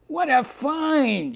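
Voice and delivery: high, slow excited shriek. A narrator's reading. The columnist gushing at the bush, high shriek. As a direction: squeal with delight at the find. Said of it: "shrieked out Maisie".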